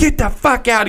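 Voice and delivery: high-pitched mock order to leave; John Taffer. Podcaster imitating the expert ejecting the owner, mock rage. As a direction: throw them out in an imitated rage. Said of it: "And he's like".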